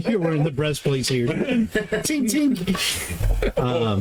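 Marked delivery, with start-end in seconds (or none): 1.3-3.6 tinking sound. Acted. Imitating metal sound.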